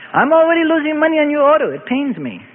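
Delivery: high, whining lament of a shopkeeper; old rabbi pleading poverty to a customer; complaining old rabbi. A narrator's reading. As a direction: moan about your losses. Rabbi complaining while haggling.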